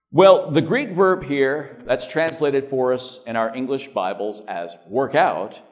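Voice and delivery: ordinary talk, nothing marked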